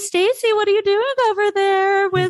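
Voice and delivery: playful retold teasing of a twelve-year-old; teasing boys. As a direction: imitate the boys razzing her over the PA. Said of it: "They're like".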